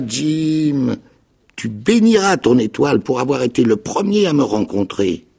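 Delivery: high, loud fervent insistence; with fervour. A narrator's reading. Castaway insists to Jim he is rich, high and loud. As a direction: insist eagerly on the secret. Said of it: "il répéta avec chaleur".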